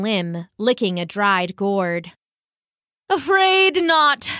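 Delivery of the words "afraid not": droll dove. Acted. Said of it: "said the dove".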